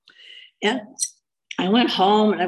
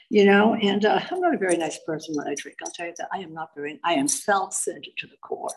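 usual speaking voice

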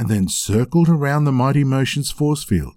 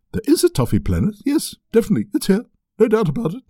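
high, quick, eager magic-map voice; talking scroll voice; 10 semitones higher, faster than usual. Acted. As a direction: gabble it out like an excitable enchanted scroll.

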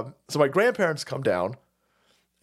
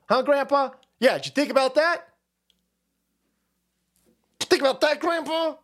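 high mocking questions at grandpa; sarcastic rant; 7 semitones higher than usual. Kid mocking grandpa about cake animals, high sarcastic rant.

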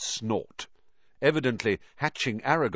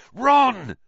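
urgent, sharp call in the dark; hissed; 14 semitones higher, 9 dB louder than usual. A narrator's reading. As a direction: hiss it urgently but loud. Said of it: "he hissed through the dark".

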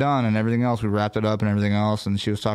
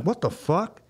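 retold shock; 7 semitones higher, slower than usual. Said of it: "I'm like".

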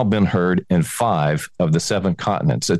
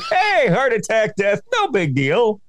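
higher, slow, carefree mock-ad voice; mock breezy; 9 semitones higher than usual. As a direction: brush off death like a cheery ad. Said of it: "they make it sound like".

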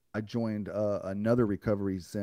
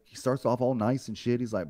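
usual speaking voice

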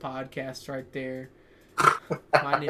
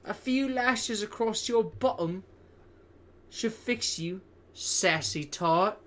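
higher, louder mock cockney rogue; hammed-up British accent; 6 semitones higher, 6 dB louder, slower than usual. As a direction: camp it up like a saucy Victorian villain. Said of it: "in that same voice".

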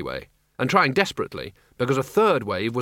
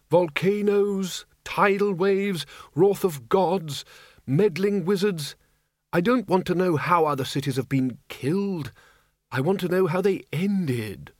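higher, slow exasperated list; frustrated listing; 5 semitones higher than usual. Acted. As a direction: tick off the useless answers. Windle frustrated with research.

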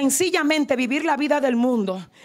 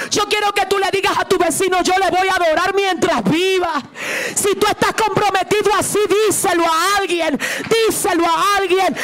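raised, high-pitched pulpit cry; impassioned preaching; 6 semitones higher than usual. Real, not acted. Preacher urging the church to adore and never leave God, impassioned preaching. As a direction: preach with fervor, building to a shouted call.

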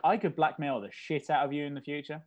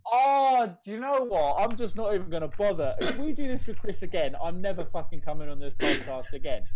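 mock outrage; 3 semitones higher, 7 dB louder than usual. Mock storming off the podcast.

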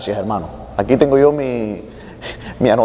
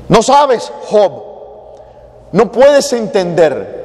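loud, slow stern rebuke, much higher; God scolding Job; 7 semitones higher, 6 dB louder, slower than usual. Retold or imitated. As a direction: thunder it down at Job. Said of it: "Por eso Dios lo regaña". (Dios regañando a Job en voz alta y lenta, mucho más aguda)

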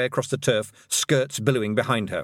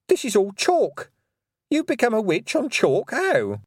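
high, slow bewildered witch; puzzled witch; 11 semitones higher, 4 dB louder than usual. Acted. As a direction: marvel at it. Miss Tick voice.

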